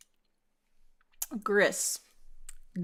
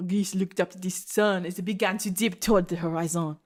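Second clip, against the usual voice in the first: put-on story narration with a French accent. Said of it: "no, he's French".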